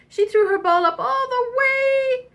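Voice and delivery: high, singsong rise on "all the way"; soaring narration. A narrator's reading. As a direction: send the voice up with the ball. Narrator mimics ball going up.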